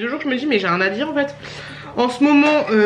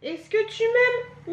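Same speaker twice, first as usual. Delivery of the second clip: supplication aiguë; 11 semitones higher, 5 dB quieter, much slower than usual. Real, not acted. Supplie le bébé en jouant.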